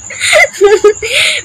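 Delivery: high, squealing laugh; burst of laughter. Real, not acted. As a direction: crack up at the comment. Laughing at a comment.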